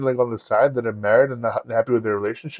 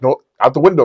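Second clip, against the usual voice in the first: insistent correction; 3 semitones higher, 5 dB louder, faster than usual. Retold or imitated. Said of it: "She's like".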